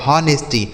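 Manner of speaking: "हॉनेस्टी" opening on a sounded H; mispronounced honesty. Shown as a demonstration. Teacher repeats the wrong reading for pupils, H voiced in "हॉनेस्टी". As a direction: pronounce the H at the start of "हॉनेस्टी". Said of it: "इसे हॉनेस्टी नहीं पढ़ा जाएगा".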